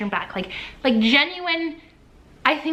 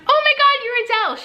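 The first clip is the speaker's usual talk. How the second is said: high, loud starstruck squeal; starstruck gush; 12 semitones higher, 3 dB louder than usual. Retold or imitated. Fan meeting her idol, high excited squeal. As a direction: blurt it out in disbelief.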